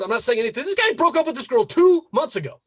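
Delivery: ordinary talk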